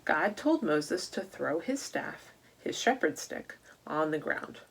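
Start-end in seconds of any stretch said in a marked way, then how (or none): none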